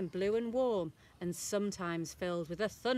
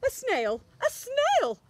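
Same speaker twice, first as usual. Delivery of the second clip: surprised shriek; 13 semitones higher, 7 dB louder than usual. A narrator's reading. Teacher sees snail on board.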